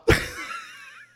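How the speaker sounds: squealing laugh after the punchline; real wheezy cackle; high-pitched laugh. Real, not acted. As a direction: crack up helplessly, very high. Cracks up at his own reveal.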